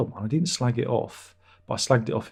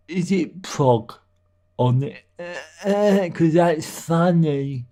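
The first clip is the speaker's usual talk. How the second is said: higher, slow, whiny imitation of a pestering fan; a teasing put-on fan voice, higher and slow; mocking fan voice; 5 semitones higher, 6 dB louder, slower than usual. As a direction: drag out the name like a fan moaning at you. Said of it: "I imagine that's how they talk".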